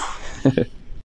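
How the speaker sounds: short, quiet breathy laugh; soft chuckle. Real, not acted. Teacher laughs at own language slip, soft chuckle. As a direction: chuckle softly to yourself.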